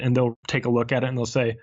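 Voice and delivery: usual speaking voice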